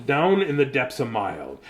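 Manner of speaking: plain reading voice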